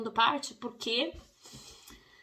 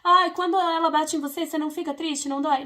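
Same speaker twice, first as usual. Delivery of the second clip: pleading parent; 6 semitones higher, 7 dB louder than usual. Retold or imitated. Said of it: "aquele argumento".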